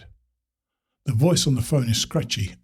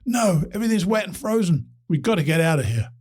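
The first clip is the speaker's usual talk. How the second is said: distant phone voice; 6 semitones higher than usual. Acted. Caller urgent on frozen mountain.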